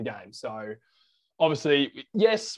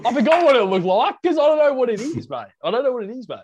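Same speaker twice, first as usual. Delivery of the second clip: high, loud exasperated rant; exasperated outburst; 8 semitones higher, 6 dB louder than usual. Real, not acted. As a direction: throw your hands up in disbelief. Exasperated at not knowing lid.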